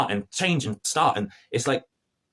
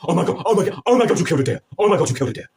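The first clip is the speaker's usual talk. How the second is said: higher, louder hammed-up panic; imitating a panicking guard captain, higher and louder; mock freaking out; 7 semitones higher, 5 dB louder than usual. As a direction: play the guard losing it over a deer. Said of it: "He, he's freaking out".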